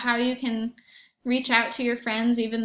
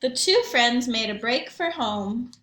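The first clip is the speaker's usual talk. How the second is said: put-on lively read-aloud for young children; story narration. A narrator's reading.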